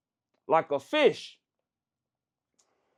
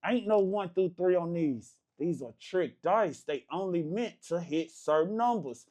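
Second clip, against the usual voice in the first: usual speaking voice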